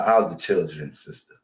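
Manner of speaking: usual speaking voice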